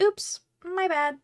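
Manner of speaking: high, slow sheepish oops; mock oops. After stabbing the wrong spot, high sheepish oops. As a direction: play it innocent and slow.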